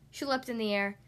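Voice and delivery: ordinary delivery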